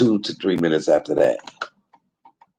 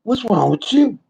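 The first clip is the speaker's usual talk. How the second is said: higher, louder mock-scolding God; voicing God; 11 semitones higher, 4 dB louder than usual. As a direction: play God teasing him, pitched up. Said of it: "God said".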